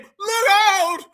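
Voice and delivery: long, drawn-out high shout; hammed-up slow holler; mock warning yell. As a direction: stretch the warning out, mimicking him. Mimics the played man's warning yell.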